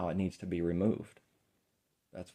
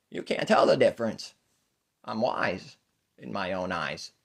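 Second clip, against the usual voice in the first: put-on God baby; 3 semitones higher, 8 dB louder than usual. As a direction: crow like an all-knowing infant. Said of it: "God baby".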